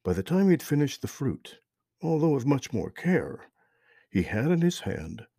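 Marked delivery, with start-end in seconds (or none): none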